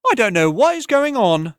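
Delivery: high, loud and ringing like a bell; a voice that floats above the tavern noise; clear ringing woman's voice. Acted. Tavern woman calls out over the crowd, high and ringing. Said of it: "voice that was clear as a clarion bell".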